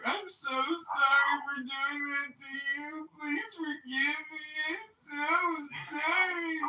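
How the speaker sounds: high, slow, tearful imitation of her; mock crying apology. Imitating the crying girlfriend who says sorry, high and tearful. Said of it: "She was like, crying".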